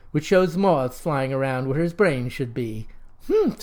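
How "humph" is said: high squeaky humph. A narrator's reading. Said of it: "says he".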